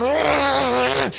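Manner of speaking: mock ferocious attack cry; dogs' growl-scream. Dogs tearing into the witch, wild cry.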